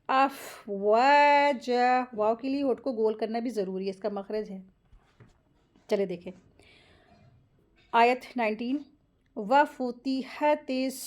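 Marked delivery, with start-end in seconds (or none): 0.1-2.1 अफ़वाजा with both long a vowels held; stretched madd. Shown as a demonstration. Teacher models madd at a stop, अफ़वाजा slowly stretched. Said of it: "तो कैसे पढ़ेंगे". 9.4-10.9 वफ़ुतिहतिस slow, ending on a held s; careful recitation. Shown as a demonstration. Teacher models short vowels, measured वफ़ुतिहतिस with held s. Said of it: "इस तरीके से पढ़ेंगे".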